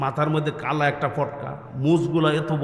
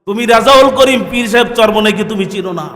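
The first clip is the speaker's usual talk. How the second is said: raised, forceful preacher's question; loud challenge; 5 semitones higher, 11 dB louder than usual. Real, not acted. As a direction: throw the challenge out loudly to the crowd. Challenge repeated at greater force. (জোর গলায় প্রশ্ন)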